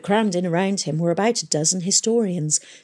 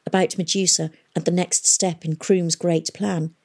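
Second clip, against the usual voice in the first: plain reading voice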